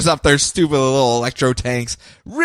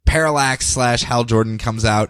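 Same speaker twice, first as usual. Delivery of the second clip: ordinary talk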